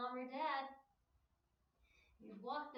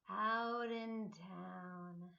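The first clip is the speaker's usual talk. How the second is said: slow exhale; 6 semitones lower, much slower than usual. Shown as a demonstration. Teacher guiding kids through a slow breath, drawn out. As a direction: let the words sink out with the breath. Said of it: "Do it with me".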